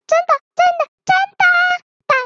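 very high, breakneck little giraffe; over-excited child. A narrator's reading. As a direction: gush it out high and fast, barely stopping. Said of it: "你不要那么激动".

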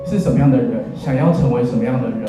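usual speaking voice

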